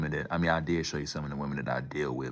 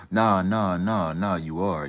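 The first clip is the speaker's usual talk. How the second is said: loud, high no, no; emphatic protest; 4 semitones higher, 9 dB louder, slower than usual. Real, not acted. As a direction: cut them off loudly. Protesting the chat comment.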